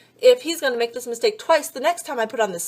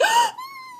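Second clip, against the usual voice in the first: big high offended gasp; shocked gasp. Retold or imitated. Wife offended by husband's rant, high dramatic gasp. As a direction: gasp as if deeply wounded. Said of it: "that's what I do".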